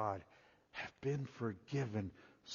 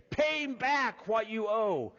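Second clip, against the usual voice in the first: higher, louder, slow threat; harsh demand; 10 semitones higher, 7 dB louder, slower than usual. Acted. Slave choking his fellow slave, demanding payment loudly and slowly. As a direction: demand the debt while choking him. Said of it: "began to choke him, saying".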